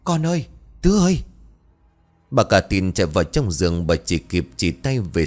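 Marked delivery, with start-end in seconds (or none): 0.0-1.2 calling out. Acted. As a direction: cry out for her son in panic. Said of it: "thì cất tiếng gọi". (gọi con thất thanh, cao và to)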